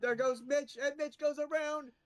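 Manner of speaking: high-pitched call of a spin; excited play-by-play. Real, not acted. Commentator calling Mitch's spin, excited play-by-play. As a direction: ride up high as the car goes round.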